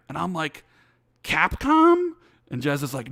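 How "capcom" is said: excited guess. Retold or imitated. Guessing who Microsoft bought.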